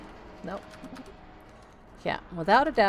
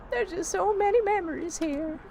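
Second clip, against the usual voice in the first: high-pitched granny lament; acted mournful old-lady voice; wistful old lady; 14 semitones higher than usual. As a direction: play a sorrowful old woman, voice held high. Old tenant mourns her home.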